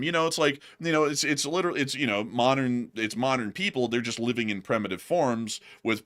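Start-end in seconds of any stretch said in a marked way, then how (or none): none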